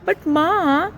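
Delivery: put-on high, whiny child's protest; a boy begging his mother; pleading kid. A narrator's reading. Putti pleading with Ma, high whiny.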